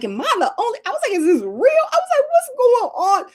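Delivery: high, rising panic, voice climbing each time; panicked disbelief. Retold or imitated. Retelling her own frozen shoulder scare, high anxious voice. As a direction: replay the shock of realizing something's wrong.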